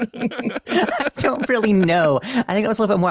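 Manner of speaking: words through real laughter; laughing. Real, not acted. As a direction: giggle it out slowly.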